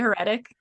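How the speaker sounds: "heretic" as huh-RET-ik; mispronounced heretic. Shown as a demonstration. Checking how co-host usually says it, heretic mispronounced as huh-RET-ik. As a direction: shift the stress to the second syllable of "heretic". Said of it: "You said it correctly".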